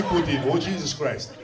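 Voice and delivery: ordinary talk, nothing marked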